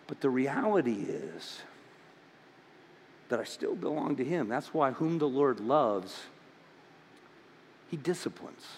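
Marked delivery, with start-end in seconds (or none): none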